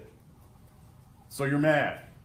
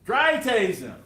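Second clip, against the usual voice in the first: higher, louder imitation of a yelled order; retold screaming; 6 semitones higher, 6 dB louder, slower than usual. Prosecutor imitating the female officer yelling to the jury, loud. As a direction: belt out the officer's demand, retold.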